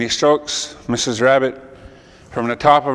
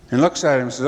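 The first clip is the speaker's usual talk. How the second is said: ordinary delivery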